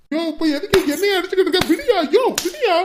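high, loud and fast yelping; shrill protest. Acted. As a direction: yelp while being hit. Rescuer being beaten, protesting. (அடி வாங்கும்போது கத்தல்)

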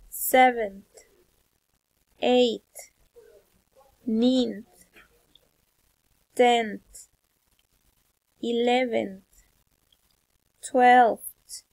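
isolated words with long pauses; teacher leading a pronunciation drill; repeat-after-me drill. Shown as a demonstration. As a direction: model each ordinal firmly, then wait.

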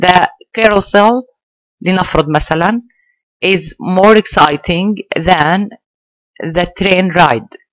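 very slow, word by word example; slow model sentence. Shown as a demonstration. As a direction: dictate it slowly so students follow. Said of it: "teacher models example sentence slowly". (جملة نموذجية ببطء شديد)

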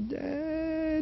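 very high, soft, drawn-out wail; little far-off voice. Retold or imitated. Daughter calling her father from away off, tiny high voice. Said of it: "I heard a little voice".

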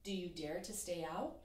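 ordinary delivery, nothing marked

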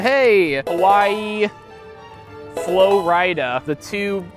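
ordinary talk

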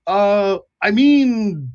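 high-pitched, flustered sentry voice; hesitant, high guard correcting himself; bumbling guard. Acted. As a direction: fumble the official challenge in a high voice. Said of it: "the guard on one of the towers hails you".

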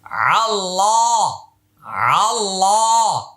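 আল্লাহ with heavy full l, slow; heavy Allah. Shown as a demonstration. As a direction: draw out আল্লাহ thick. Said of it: "আল্লাহ শব্দের উচ্চারণ মোটা হবে". (মোটা করে আল্লাহ)

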